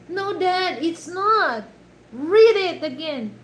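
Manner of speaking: high, loud mock correction; insistent daughter. Daughter telling dad to read it, insistent correction. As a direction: imitate a daughter firmly contradicting her dad. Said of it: "the daughter said".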